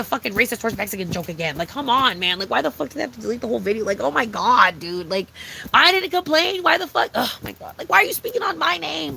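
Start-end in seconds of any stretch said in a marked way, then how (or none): 5.7-9.1 high, indignant rant; outraged at being blamed; pitched-up indignant complaint. Real, not acted. Ranting about deleted video.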